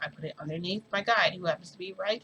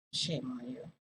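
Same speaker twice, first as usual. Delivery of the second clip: put-on low, softer scolding as the boyfriend; 5 semitones lower, 6 dB quieter than usual. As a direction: drop low and scold him like a stern boyfriend. Boyfriend Toshinori scolding, voiced.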